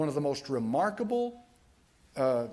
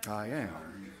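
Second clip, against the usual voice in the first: "I am." much lower and softer, almost breathed; hushed I am; 7 semitones lower, 7 dB quieter than usual. Shown as a demonstration. Preacher repeats the divine name softly for effect. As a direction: drop the voice right down on "I am.". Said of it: "how does he say it?".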